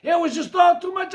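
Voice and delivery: high, loud narration building to a shout; frantic story narration. A narrator's reading.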